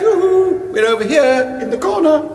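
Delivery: a high fluting mother's call across the room; sounds like his mother. Acted. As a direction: sing out high and bright like a doting mum. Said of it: "My mother?".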